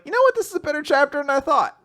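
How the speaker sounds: high, quick mock realization; pleasantly surprised. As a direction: pop up with a sudden change of heart. Speaker's own retold realization.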